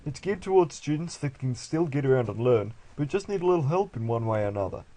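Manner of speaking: put-on Australian accent. Parent selling the new school, loud mock Australian accent. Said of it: "people in Japan sound Australian".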